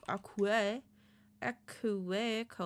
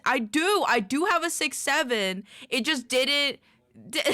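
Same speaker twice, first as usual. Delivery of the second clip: loud, high-pitched insistence; emphatic protest; 7 semitones higher, 11 dB louder than usual. Real, not acted. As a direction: push back loudly at the chat. Playfully protesting to chat.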